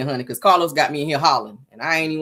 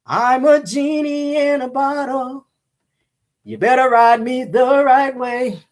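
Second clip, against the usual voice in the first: high, slow, drawn-out sultry genie voice; mock seductive genie; 8 semitones higher, slower than usual. Host voicing the reality star as a sultry genie, slow and mocking. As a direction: purr it like a hammed-up genie in a bottle. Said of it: "Talking about".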